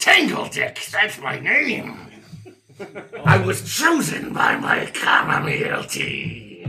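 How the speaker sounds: slow, grandiose villain, falling into a voiceless rasp; boastful monster. Acted. Monster proudly names itself to its foe, slow raspy boast. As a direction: proclaim it like a creature, drawing words out.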